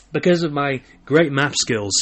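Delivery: slow, emphatic mock boast; sarcastic. Real, not acted. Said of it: "I am obviously being sarcastic here".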